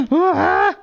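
gasp, then high, loud scream; sharp gasp into a yelp of fright; startled scream. Real, not acted. Player ambushed in a game, startled scream.

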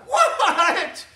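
high, loud shocked cry with a laugh; shocked what. Retold or imitated. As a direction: throw it out in disbelief. Disciples' shocked reaction.

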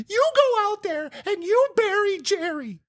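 high, bossy mimic of girlfriend; imitating girlfriend. Girlfriend ordering a proper burial, high bossy mimic. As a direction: order him to bury it. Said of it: "So then she fucking names it".

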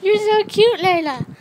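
high, loud cooing at a pet; gushing pet talk. Real, not acted. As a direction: squeal with adoration at your little pet.

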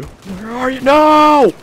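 real alarm as he gets shot; anguished high no; rising panicked no. Real, not acted. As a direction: pitch shoots up into a loud cry of no. Shot by a fifty caliber.

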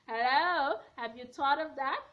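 ordinary delivery

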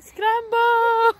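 high, loud imitating shout; yelling from the sidelines. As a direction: yell it like the kid. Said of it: "Aria's like".

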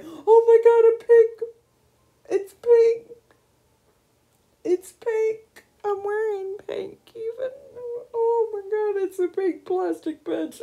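squealing high, close to crying; overwhelmed with delight, voice breaking; near tears of joy. Real, not acted. Gamer squealing over a pink bench. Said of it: "be crying almost".

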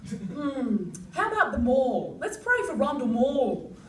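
lightly voiced Jeremy. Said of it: "Jeremy's like".

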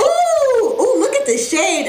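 high imitated auntie "ooh"; admiring auntie. Aunties admiring the child's hair colour, high imitated cooing. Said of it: "They're just like".

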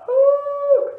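high, loud drawn-out call; long high shout. A narrator's reading. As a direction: cry out as an eager bear. Bear calls out, excited recognition.

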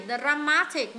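slow "dramatic" with MA stretched; stress on second syllable. Shown as a demonstration. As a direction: lean hard on the middle of dramatic. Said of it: "nhấn âm hai".

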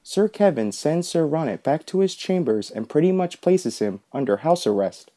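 plain reading voice

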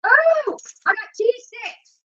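high, drawn-out excited squeal; delighted discovery. Real, not acted. Cook finds cheese sticks to fry, excited high coo. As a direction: coo it high and slow, thrilled.

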